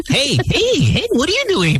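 excited greeting. Real, not acted. Greeting a guest in mock surprise.

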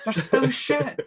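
high mock dismissal; hammed-up flat rejection; dismissive reply. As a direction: scoff it off high. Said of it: "you'd be like".

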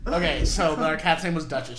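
normal talking voice

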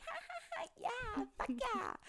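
high, hammed-up fake laugh then a cheer; mock excited cheer. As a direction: play it like a giddy teen, way up high. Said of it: "And I was like".

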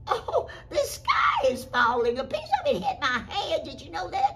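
high, loud, wailing panic; hammed-up storybook alarm, stretched at first; alarmed hen. Henny Penny warns the goose, wailing in alarm.

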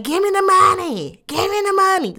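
high, loud mock yell; imitating a yell. [name] yelling at [name] for money, mock shout. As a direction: bark it like a demanding nag. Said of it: "you're gonna yell at this man".

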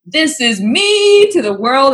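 slow, high, loud proud proclamation; retold excited declaration, stretched out. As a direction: announce yourself grandly, mock-triumphant. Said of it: "I get all excited".

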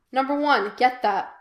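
loud call across the house. A narrator's reading. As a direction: call out to someone in another room. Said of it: "Pike called".

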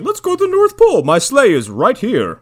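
high, loud hammed-up exclamation; mock jolly announcement. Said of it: "he's like, he's like".

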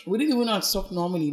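ordinary talk, nothing marked

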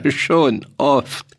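laughing it off; chuckle, then answer lightly; amused laugh. A narrator's reading. Soldier laughs off the farmer's taunt.

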